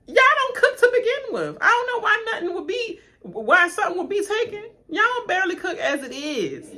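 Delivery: incredulous rant. Real, not acted. Mocking snowstorm shoppers, high laughing disbelief. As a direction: scoff at them through laughter.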